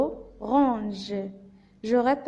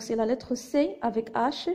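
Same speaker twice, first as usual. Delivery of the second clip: usual speaking voice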